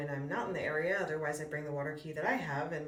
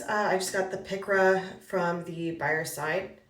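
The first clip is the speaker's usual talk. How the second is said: ordinary delivery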